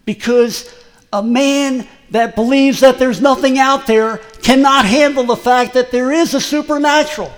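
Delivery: loud, high preacher's proclamation; preaching at full force. Acted. Preacher declaring to the congregation, loud and fervent. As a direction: thunder it from the pulpit.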